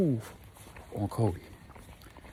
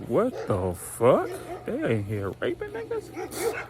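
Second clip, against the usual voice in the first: slow, drawn-out high squeal of disbelief; mock-appalled, pitch soaring; retold shocked disbelief; 12 semitones higher, slower than usual. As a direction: stretch each word, climbing very high. Red shocked on learning the meaning.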